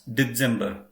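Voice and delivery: December said with a hard TS, as dets-EM-ber; German pronunciation model. Shown as a demonstration. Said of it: "can everybody please try once".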